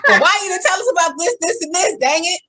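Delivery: loud, high-pitched playful comeback; mock exasperation. Co-host playing the questioners who want to be told, mock exasperated. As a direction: fire back in hammed-up indignation.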